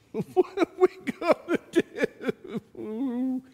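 high, hushed frightened question; scared servant. Retold or imitated. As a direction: voice the servant's fear again. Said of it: "Elijah's servant is scared".

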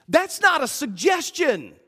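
ordinary delivery

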